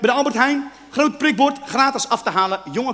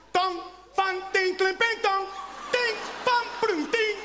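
high clattering string of nonsense syllables; ringing mock clangs; imitated clanging wok; 8 semitones higher, slower than usual. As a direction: mimic a pan bouncing noisily on the floor. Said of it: "Nou, dat geluid, zo heet dat kind".

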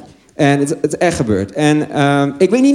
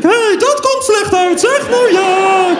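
very high, slow put-on voice; high, slow comic falsetto, laughing at the end; silly little voice; 3 dB louder, slower than usual. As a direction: squeak it out like a cartoon figure, drawn out. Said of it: "deed er nog net geen stemmetje bij".

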